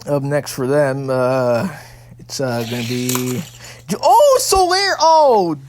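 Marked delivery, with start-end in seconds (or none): 4.0-5.6 high, loud cry of surprise; shocked shout. Real, not acted. Commentator watching Solaire's attack backfire, shocked shout. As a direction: shout out as it all goes wrong.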